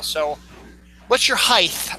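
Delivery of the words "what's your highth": "height" said as "highth"; mispronounced height. Retold or imitated. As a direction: put a TH on the end of HEIGHT. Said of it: "I've never heard that".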